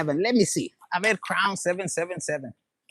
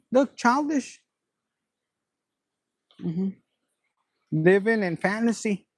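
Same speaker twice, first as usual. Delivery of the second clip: ordinary delivery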